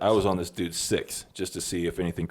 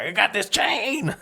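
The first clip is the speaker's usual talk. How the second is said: high, drawn-out gloating cry; excited boast; 13 semitones higher, slower than usual. Retold or imitated. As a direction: show off the prize, slow and high. Retold triumphant boast while jumping around.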